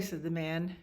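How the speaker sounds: ordinary delivery, nothing marked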